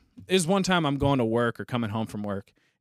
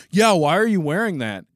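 mock a pointed, judging challenge; loud retold challenge, pitched up; accusing challenge; 4 semitones higher, 7 dB louder than usual. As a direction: imitate a louder, higher confrontational question. Neighbor's challenge acted out.

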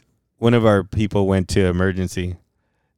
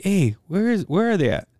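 high-pitched phone query; raised-pitch retold question; acted questioning; 11 semitones higher than usual. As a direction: ask it high and impatient, retelling a call. Acted phone call asking whereabouts.

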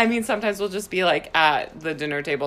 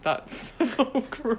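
laughing; 5 semitones higher, 4 dB quieter, slower than usual. Real, not acted. Both laughing at gross story.

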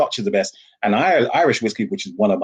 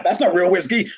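mock American scoff; 10 semitones higher than usual. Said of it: "The Americans like".

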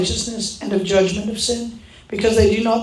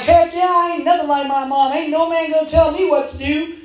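higher-pitched sassy retort, putting on attitude; defiant boasting; 6 semitones higher than usual. Retold or imitated. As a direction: play the proudly independent woman, hammed-up. Acting her younger defiant self.

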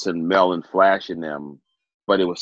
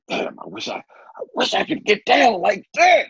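amazed. Retold or imitated. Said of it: "I was like".

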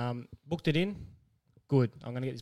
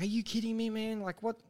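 higher, softer voice of mock dismay; acted disbelief; 6 semitones higher, 5 dB quieter than usual. Customer feigning upset to the bull bar shop, disbelieving. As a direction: play up polite incredulity, pitched high and soft.

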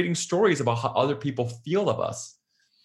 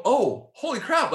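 mock realization; 11 semitones higher, slower than usual. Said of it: "And then we go".

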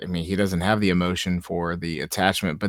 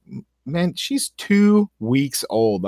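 high-pitched, drawn-out disbelief; slow, high, appalled protest; incredulous; 7 semitones higher, much slower than usual. Retold or imitated. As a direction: stretch it out in exasperated amazement. Acting out own disbelieving reaction.

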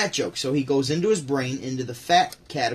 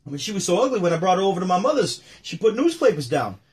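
high, loud punchlines with a big whew; hammed-up comic exasperation; Rodney Dangerfield bit; 4 semitones higher, 5 dB louder than usual. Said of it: "And then he goes".

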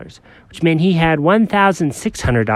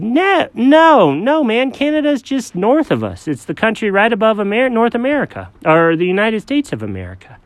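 lightly voiced dog, patient; 10 semitones higher than usual. Alfred voiced higher.

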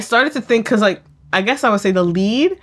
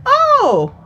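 much higher, put-on casual coincidence; pretend chirpy surprise; 12 semitones higher, slower than usual. Catfish tells online friend they are nearby, put-on surprise. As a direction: play an online acquaintance happening to be nearby.

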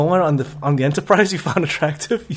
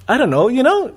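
ordinary talk, nothing marked

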